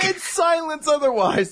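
high, slow incredulous delivery; hammed-up amazement at the obvious; mock disbelief. Joking about noisy toys in a silent classroom, mock disbelief.

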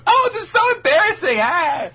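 high, loud mock-mortified squeal; embarrassed squeal. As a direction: squeal it in hammed-up girlish embarrassment. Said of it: "she immediately goes".

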